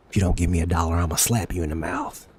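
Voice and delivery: joking gangster threat; mock threat. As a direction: play a menacing thug.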